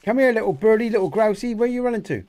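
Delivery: high, loud coaxing call; coaxing a wild bird. Acted. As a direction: sweet-talk a bird to come closer. Calling to a bird.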